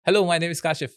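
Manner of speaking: smooth, crisp "Hello, my name is Kashif" in one flowing breath; fluent, assured "Hello" with a clean falling finish; confident intro. Shown as a demonstration. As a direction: introduce yourself like you own the room. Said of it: "ये बहुत different sound कर रहा है".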